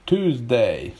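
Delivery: American "Tuesday" said as TOOZ-day; no y sound. Shown as a demonstration. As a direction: drop the y in "Tuesday". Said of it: "no y sound".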